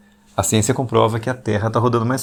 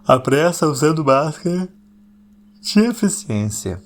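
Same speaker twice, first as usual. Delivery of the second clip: dramatic announcement; 5 semitones higher, slower than usual. Shown as a demonstration. Podcaster unveiling a phrase to listeners, dramatic and drawn out. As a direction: announce it grandly, slowing onto the final word.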